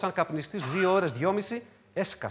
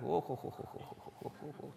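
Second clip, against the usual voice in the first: hushed, voiceless panicky puffs; acted-out flustered panting; mock panic; 3 semitones lower, 15 dB quieter than usual. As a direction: panic under your breath in rapid little gasps. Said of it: "τώρα πανικός".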